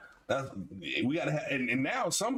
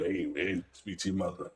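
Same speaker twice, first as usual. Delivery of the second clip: much lower, put-on grown-man voice; deep tough-guy voice; 12 semitones lower than usual. Calling the girlfriend's house and faking a deep voice to her son. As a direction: drop deep and sound like you could fight. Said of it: "I had to put a little extra on it".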